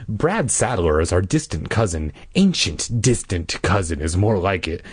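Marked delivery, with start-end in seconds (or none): none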